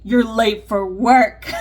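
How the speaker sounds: loud, slow warning as anxiety itself; anxiety's alarm call. Acted. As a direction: voice anxiety jolting you awake, slow and loud. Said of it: "anxiety wakes you up and says".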